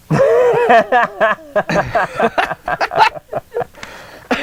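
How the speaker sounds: a long burst of real laughter, pitched high; long, high helpless laugh. Real, not acted. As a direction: collapse into laughter at the poll.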